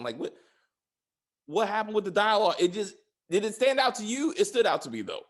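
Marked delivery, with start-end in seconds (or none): none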